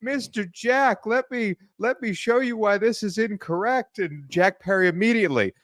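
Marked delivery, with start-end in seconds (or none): 0.0-4.0 mock patronizing boss. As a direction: talk down to him like a fussy boss. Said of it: "Phil comes walking in in his big suit".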